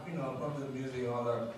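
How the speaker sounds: usual speaking voice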